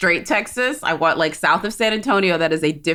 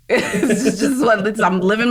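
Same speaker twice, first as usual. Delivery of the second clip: laughing; 5 semitones higher than usual. Real, not acted. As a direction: chuckle while defending yourself. Laughing while defending her point.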